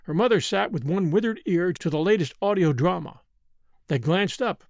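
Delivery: usual speaking voice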